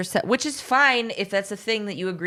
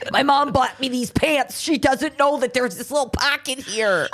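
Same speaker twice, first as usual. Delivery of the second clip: high-pitched raver kid impression; higher-voiced teen raver send-up; nineties raver kid; 7 semitones higher than usual. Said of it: "my nineties fucking raver kid".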